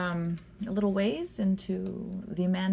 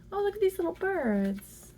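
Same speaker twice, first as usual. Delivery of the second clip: cooing high voice; 10 semitones higher than usual. Real, not acted. Coos at birds card.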